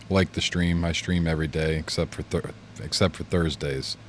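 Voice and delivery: ordinary talk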